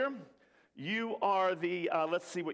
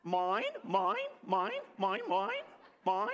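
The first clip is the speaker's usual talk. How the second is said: short, repeated seagull squawks; staccato seagull chant; seagull call; 3 semitones higher than usual. Shown as a demonstration. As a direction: squawk it over and over like a gull. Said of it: "Anybody know what the seagulls say".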